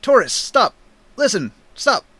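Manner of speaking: high, pleading call to halt; urgent Orin. Acted. Orin tries to stop raging Taurus, urgent calling. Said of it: "Orin approaches you and says".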